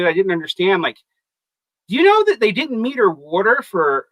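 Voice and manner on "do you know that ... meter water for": slipping into a Southern accent. Real, not acted. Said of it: "my Southern accent just came out".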